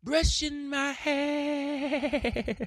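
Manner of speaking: very high, drawn-out falsetto with a wavering tail; silly falsetto ASMR parody, quavering off at the end; joke ASMR demo. Shown as a demonstration. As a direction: stretch each word way up high. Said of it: "I could do an A S M R today".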